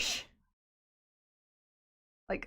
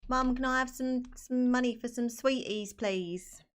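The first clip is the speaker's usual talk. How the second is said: put-on higher-pitched British child begging mum; put-on British accent; 4 semitones higher, 3 dB louder than usual. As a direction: plead for sweeties in a British accent, held high. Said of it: "No, don't speak like".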